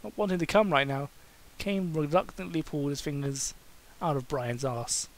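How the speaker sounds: ordinary delivery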